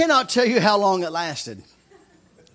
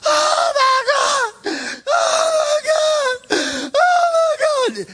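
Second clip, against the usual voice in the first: high, loud, stretched-out wail; imitated screaming; 7 dB louder, slower than usual. Preacher mimicking the guy on the floor, imitated screaming. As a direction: scream it slowly at full pitch like the overcome man. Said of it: "this guy's on the floor screaming".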